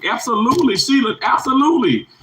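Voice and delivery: high, excited affirmation; emphatic agreement. Real, not acted. Streamer backing Sheila's comment, emphatic agreement.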